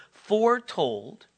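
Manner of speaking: "foretold" stretched slowly, lifting at the end; "foretold" weighty and drawn out; stress on foretold. Real, not acted. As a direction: repeat "foretold" slowly for emphasis. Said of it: "underline that word".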